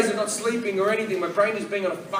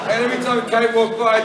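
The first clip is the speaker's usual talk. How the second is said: ordinary delivery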